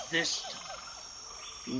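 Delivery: breathy whispered threat; menacing whisper. Acted. Boss whispering a threat to his agent. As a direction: let the threat hang in a whisper.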